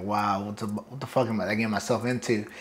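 low, hushed muttering to himself; soft, low retold self-doubt; nervous inner thought. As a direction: quietly voice a stunned, nervous thought. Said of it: "nervous as fuck".

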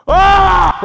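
sudden loud, high yell; loud high scream. Real, not acted. Frustrated player at the game, loud high scream.

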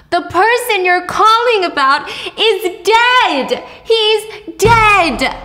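high, loud yell that he's dead; hammed-up grieving yell; mock scream. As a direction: shout it down the phone in grief. Widow screaming on phone.

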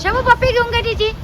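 खूप उंच, गोड बाळबोली आवाज; लहान बाळाला लाडाने समजावल्यासारखं; बाळाशी लाडात. Real, not acted. (बाळाला पप्पी घ्यायला लाडिक आवाजात सांगणारी आई)

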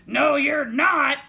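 slow, loud, menacing troll. A narrator's reading. Troll refusing the little goat, loud and slow. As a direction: boom it out word by word. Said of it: "said the troll".

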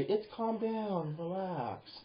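drawn-out, quiet reassurance from a boss; calm boss. Acted. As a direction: ease them down gently. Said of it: "my boss at work were to say".

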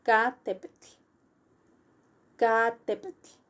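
"Catepetl" as a separate drill, voiceless tl ending; word-building demo. Shown as a demonstration. Teacher adding the verb bit by bit, isolated repetitions. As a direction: repeat "Catepetl" for learners, pausing between takes. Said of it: "Now we go to the verb".

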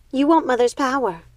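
a high accusing cry; exclaiming. A narrator's reading. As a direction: catch her sister out. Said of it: "Primrose exclaimed".

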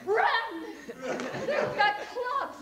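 high, urgent shout; panicked kid's cry, acted out; alarmed yell. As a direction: yell a warning to your friends. Child yelling alarm to fleeing friends.

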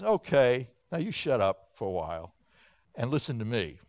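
low, soft, firm put-down; understated stern authority; God silencing Job. Acted. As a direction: drop low and quiet, calmly taking control.

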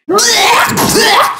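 high, hammy "blech"; disgusted spitting noise. Acted. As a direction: retch theatrically at a foul taste. Villain disgusted by the taste.

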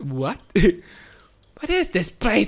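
normal talking voice

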